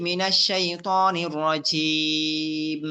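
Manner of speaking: slow, measured tajweed chant; Quran recitation. Shown as a demonstration. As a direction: recite with drawn-out vowels. Formal Quran recitation opening.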